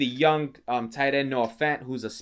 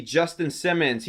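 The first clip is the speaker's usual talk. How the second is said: ordinary talk, nothing marked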